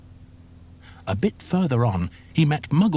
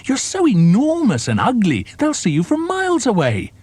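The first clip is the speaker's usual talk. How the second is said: high, mocking little croc voice; small crocodile; 12 semitones higher, 4 dB louder than usual. A narrator's reading. Small croc taunting the enormous crocodile, high and mocking. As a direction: sneer up at the big one in a high voice. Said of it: "the not-so-big one said".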